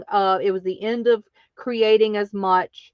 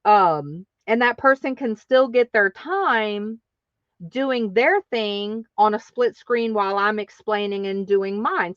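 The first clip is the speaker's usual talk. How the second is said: normal talking voice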